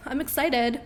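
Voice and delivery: ordinary talk, nothing marked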